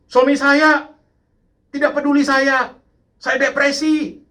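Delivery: high, loud mock complaint; imitated mothers' cry. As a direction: wail it like an aggrieved wife, hammed-up. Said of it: "Kadang-kadang ibu-ibu teriak".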